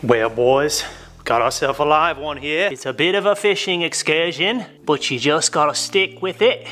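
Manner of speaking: put-on Australian accent that slides toward English; a bushman's Australian accent that keeps drifting English; hammy Australian fishing-guide accent; mock Australian accent. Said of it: "I was trying to do Australian, but then I turned, like, English".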